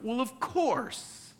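high, drawn-out sarcastic retort; exaggerated duh tone; mock obviousness. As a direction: stretch it out as if it's utterly obvious. Sarcastic reply to an obvious request.

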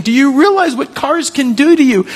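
put-on high, loud yell at the child; an angry parent yelling at a child who ran into the street; angry scolding. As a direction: scold in a burst of fright. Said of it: "as you're angry and you're saying".

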